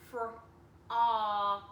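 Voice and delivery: Frog said loud and drawn; blended word. Shown as a demonstration. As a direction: sound out Frog fully. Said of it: "Just like in dog".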